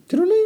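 sing-song high electronic jingle; high-pitched little ding-a-ling; notification chime imitation. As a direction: mimic a phone alert chime, very high. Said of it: "こうやってテロリンってね".

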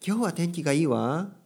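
high, lilting imitation, rising into 「わ」; feminine speech. Shown as a demonstration. Teacher repeating women's ending, high feminine lilt. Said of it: "これはちょっと女性的".

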